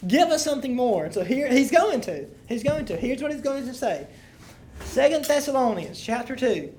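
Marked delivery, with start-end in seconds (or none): none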